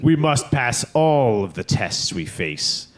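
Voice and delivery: slow, weighty, commanding emperor. Retold or imitated. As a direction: pronounce each word like a dark master. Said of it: "using the Emperor voice".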